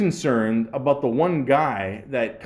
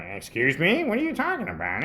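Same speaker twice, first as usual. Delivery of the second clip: 1 mock indignant boss; 4 semitones higher than usual. Mimics boss's indignant reply.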